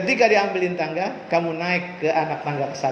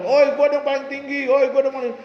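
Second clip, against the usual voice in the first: higher, cocky shout from the top; a kid bragging to everyone below, higher and cocky; boastful brag; 7 semitones higher, 5 dB louder than usual. Retold or imitated. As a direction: crow like a show-off kid on the ladder. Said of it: "dianya sombong".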